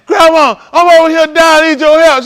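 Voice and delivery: high, loud pretend cry for help; mock desperate plea. Pretending to call grandma for help, high and loud. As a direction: ham up a dying grandchild. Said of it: "I'll be the call".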